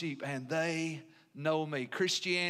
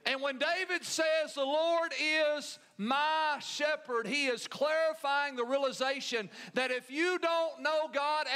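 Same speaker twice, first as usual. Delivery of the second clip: raised, fervent pulpit voice with long slow endings; rising revival sermon delivery; preaching; 7 semitones higher than usual. Acted. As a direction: build it like a preacher driving the point home. Preaching to the congregation.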